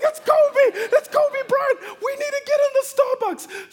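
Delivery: high, loud laughing yell; screaming with glee at the celebrity; frantic excited shout. Retold or imitated. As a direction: shout it like a starstruck fan. Starstruck parents rushing the car.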